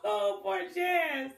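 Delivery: slow, high, laughing oh poor Jazz; laughing pity. Real, not acted. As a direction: chuckle the pity out.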